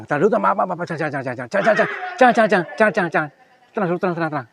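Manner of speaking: high, loud, rapid jangan with a nervous laugh; panicked pleading. Real, not acted. Ghost hunter begging the spirit not to shoot, panicked and high. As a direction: stop him in a panic.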